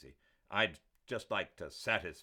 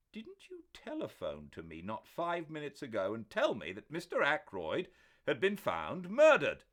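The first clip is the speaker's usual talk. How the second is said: loud, higher demanding question; incredulous challenge; 10 semitones higher, 10 dB louder than usual. A narrator's reading. Doctor challenging Parker about the phone call. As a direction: challenge him in disbelief.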